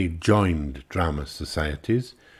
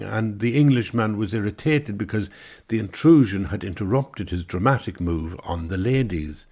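ordinary delivery

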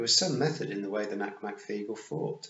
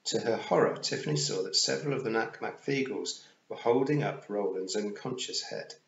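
ordinary delivery

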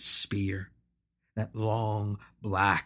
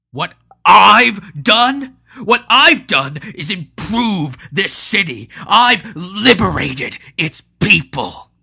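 roared out, louder and higher, the first words slowed; roaring; 10 semitones higher, 14 dB louder than usual. Acted. A furious tyrant roaring back at Patro, much louder. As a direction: roar back in fury at the accusation. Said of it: "Hanno roared".

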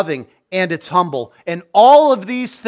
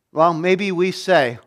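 usual speaking voice

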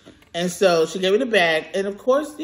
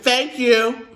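"Thank you" pushed up, a little louder and sarcastic; pointed thanks; 6 semitones higher, 4 dB louder than usual. Acted. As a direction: lean on "Thank you" to shame her into answering. Pointed thanks after silence.

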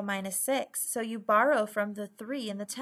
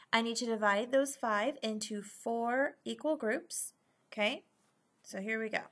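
normal talking voice

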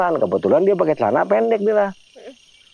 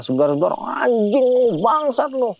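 imitated cursing, higher and drawn out; mock angry swearing with stretched vowels; rough cursing; 6 semitones higher, slower than usual. Adrian turns foul-mouthed on the trail, rough retold cursing. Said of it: "Ngomongnya udah kasar".

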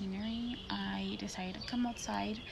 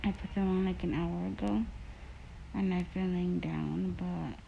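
speaking under covers; muffled through blanket; 3 semitones lower, 7 dB louder than usual. Real, not acted. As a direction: fabric over mouth. Seen 0: Blanket held over mouth and nose while lying in bed throughout.

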